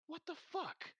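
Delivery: high-pitched incredulous demand; exasperated innkeeper. Acted. Innkeeper pulls the floating man down, incredulous and high-pitched. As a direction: take charge with a high, indignant outburst.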